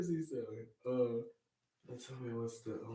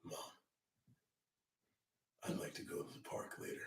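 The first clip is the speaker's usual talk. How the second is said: Batman voice; 16 dB quieter, much faster than usual. Pretend. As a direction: growl it like Batman. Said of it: "in the voice of Batman".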